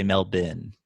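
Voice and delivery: "Melbourne" as MEL-bin, low and soft; local pronunciation. Shown as a demonstration. Showing how locals say it, "Melbourne" as MEL-bin. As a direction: swallow the end of "Melbourne" into "bin". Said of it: "They say".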